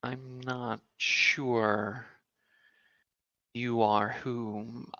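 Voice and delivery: ordinary delivery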